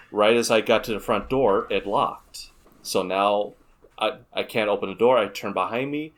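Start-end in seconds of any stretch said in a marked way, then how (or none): none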